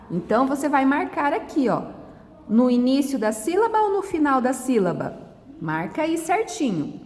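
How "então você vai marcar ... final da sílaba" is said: usual speaking voice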